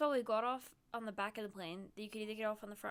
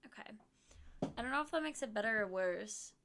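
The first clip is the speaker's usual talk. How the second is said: ordinary talk, nothing marked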